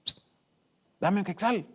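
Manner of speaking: a small pleading voice asking for a coin; a hint of a begging girl; little voice. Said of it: "esa vocecita".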